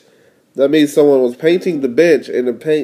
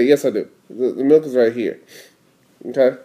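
ordinary delivery

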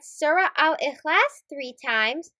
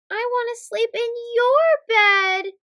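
put-on a child's higher, begging plea; pleading; 6 semitones higher, 3 dB louder than usual. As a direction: beg mama to let him stay. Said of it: "he pleads".

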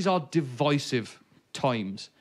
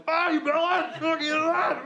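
very high rowdy shout; hollering out a van window; yelling from a van; 12 semitones higher, slower than usual. Retold or imitated. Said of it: "started going".

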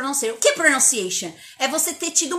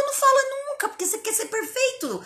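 exasperated squeak; 13 semitones higher than usual. Real, not acted. Exasperated punchline.

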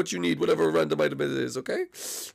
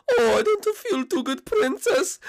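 high, cracking whimper of a sick Mario; high voice cracking with illness; slower than usual. Acted. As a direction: let the voice crack and wobble up high. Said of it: "My voice sort of keeps cracking".

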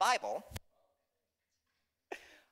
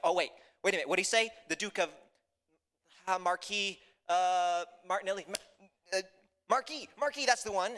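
halting, searching recall that rises into recognition; lightly voiced confused peasant; 4 semitones higher, 4 dB louder than usual. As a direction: grope for a forgotten name, then get it. Peasants struggle to recall the name.

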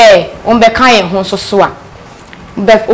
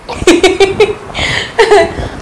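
high giggle; amused, high-pitched laughter; laughing; 11 semitones higher than usual. Real, not acted. Host teasing the guest over dating, laughing.

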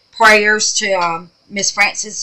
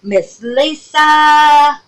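slow, loud drawn-out name; drawn-out call; 8 semitones higher, 5 dB louder, much slower than usual. Real, not acted. Announcing name of featured friend.

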